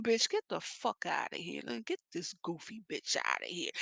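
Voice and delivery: soft, dropped-down aside after fake sweetness; mock muttered aside. Leasing lady mutters about the goofy woman, softly. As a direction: turn away and mutter it under your breath. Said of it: "leasing lady's aside".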